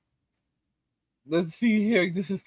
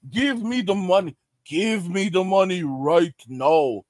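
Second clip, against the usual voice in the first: a corrupt cop demanding cash; mock menacing demand. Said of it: "That's Gen Z".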